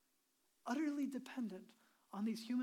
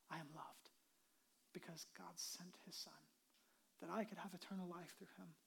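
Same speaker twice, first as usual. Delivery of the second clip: hushed confession; 5 semitones lower, 13 dB quieter than usual. Acted. Quoted believer's confession, hushed.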